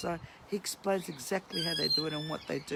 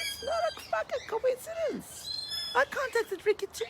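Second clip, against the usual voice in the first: high indignant squeak. Real, not acted. Ranting about Ricky to viewers, high strained outrage. Angry rant about political rivals.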